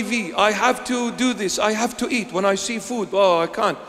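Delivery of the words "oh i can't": ordinary delivery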